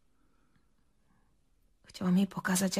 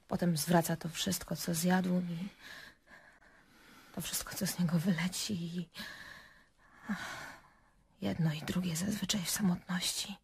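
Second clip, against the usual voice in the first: ściszone, urywane pauzami wyznanie; ciche wyznanie; 7 dB quieter than usual. Acted. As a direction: confess softly, with long halting pauses. Bolesne wyznanie o bulimii. (cicho, z trudem wyznane)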